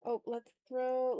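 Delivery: usual speaking voice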